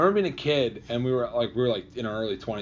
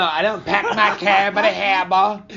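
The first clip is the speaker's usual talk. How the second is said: loud, high Boston caricature; hammed-up Boston dropped R's; mock Boston accent; 7 semitones higher, 8 dB louder than usual. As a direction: put on a broad Boston accent, loudly. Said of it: "You're not from Boston?".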